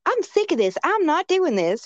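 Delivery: high-pitched whiny retort, imitated; mock defiant outburst. Podcaster retelling mean girl's refusal, mock defiant. Voicing mean girl defying teacher.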